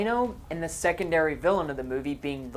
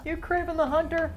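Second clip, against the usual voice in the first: high, silly character voice; mocking high voice; 12 semitones higher than usual. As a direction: parody a clumsy line. Acting out movie dialogue.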